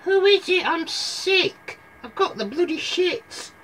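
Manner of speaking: very high, put-on granny falsetto; hammed-up poorly granny, way up high; sick granny. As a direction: complain in a squeaky old granny voice. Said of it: "The granny went".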